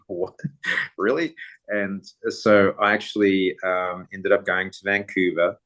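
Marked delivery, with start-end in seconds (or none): 0.1-1.3 surprised. Retold or imitated. Stunned at the invitation.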